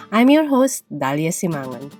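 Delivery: ordinary delivery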